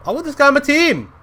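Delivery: high, loud, thrilled outburst; excited shout. Real, not acted. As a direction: shout it like an eager fan. Excitedly repeats wish to recruit him.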